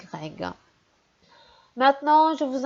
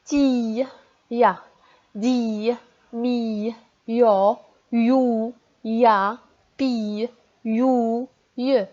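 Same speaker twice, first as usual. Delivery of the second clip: syllable reading drill; much slower than usual. Shown as a demonstration. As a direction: lead the class through the syllables. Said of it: "lisez avec moi".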